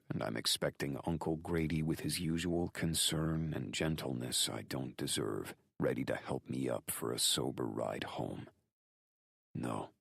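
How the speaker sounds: usual speaking voice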